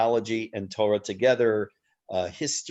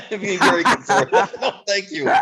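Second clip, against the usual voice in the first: laughing it off, pitched high and loud; a real laugh through the words, louder; laughing refusal to the guest, higher and louder; 9 semitones higher, 6 dB louder than usual. Real, not acted. Laughing refusal of learning Daniel.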